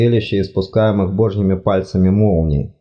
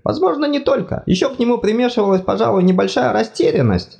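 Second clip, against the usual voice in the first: high fussy god voice, apologetic; flustered deity, much higher pitched; embarrassed god; 13 semitones higher than usual. Acted. God explains.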